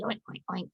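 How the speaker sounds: a calm mama pig's quiet oinking. A narrator's reading. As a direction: grunt gently like a mother pig. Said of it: "the mama pig asked".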